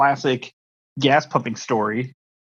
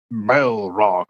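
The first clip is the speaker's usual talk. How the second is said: Gandalf impression; 5 semitones lower than usual. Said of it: "it is Gandalf going".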